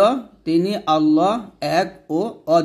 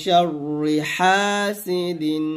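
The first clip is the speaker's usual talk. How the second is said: drawn-out chanted শাররি হাসিদিন; slow recitation; much slower than usual. Shown as a demonstration. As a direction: stretch it slowly like recitation. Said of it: "আবার".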